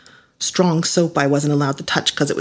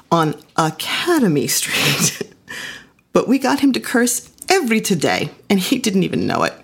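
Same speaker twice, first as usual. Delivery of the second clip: ordinary delivery, nothing marked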